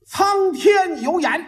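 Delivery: slow, high-pitched solemn proclamation. Acted. Reading out the sign on the mourning door, solemn and slow. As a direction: intone each word like a grand inscription.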